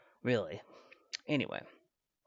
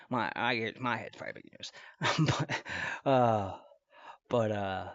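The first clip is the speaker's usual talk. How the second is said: normal talking voice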